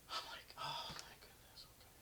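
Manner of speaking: hushed dismay. Retold or imitated. Driver alone after crashing into a pole, hushed.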